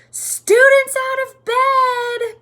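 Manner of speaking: very high, drawn-out yell; bellowed alarm. A narrator's reading. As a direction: raise the alarm at full volume. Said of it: "Peeves bellowed".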